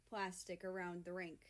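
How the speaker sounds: usual speaking voice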